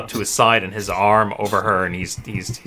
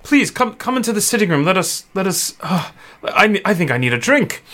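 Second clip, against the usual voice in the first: higher, flustered host; shaken father; 9 semitones higher than usual. Acted. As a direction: stammer an invitation, needing a drink. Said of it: "and he said".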